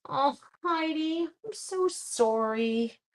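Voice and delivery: slow, gentle condolence to Heidi; sincere sympathy. Real, not acted. Sympathy to viewer with bad knee.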